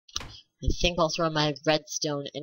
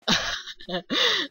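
nervous high laugh; 14 semitones higher than usual. Real, not acted. Laugh while fleeing.

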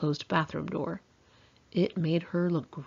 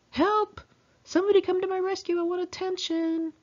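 high, hammed-up cry for rescue; mock cry for help; 14 semitones higher than usual. Teen mocking her own plea, high mock wail. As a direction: play a girl wailing for attention, much higher. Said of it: "and I thought".

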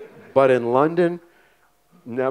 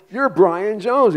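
starstruck surprise; 10 semitones higher, 6 dB louder, slower than usual. Retold or imitated. Meeting a famous star unexpectedly.

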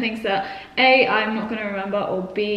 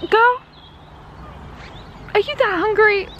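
high teasing coo; mock scolding the dog; 9 semitones higher than usual. Real, not acted. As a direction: tease the dog fondly. Addressing the dog.

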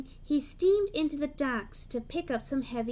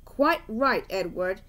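huffy; 4 semitones lower, 5 dB louder than usual. A narrator's reading. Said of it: "huffed Gordon".

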